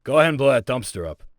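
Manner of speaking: normal talking voice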